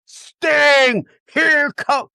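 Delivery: high excited shout of the name. Real, not acted. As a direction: burst out with the answer. Excited reveal of the answer.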